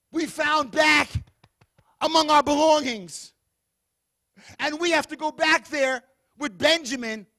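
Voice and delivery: preaching at full force. Acted. Preacher to congregation, brothers' bad news, loud. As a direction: hammer each bad news.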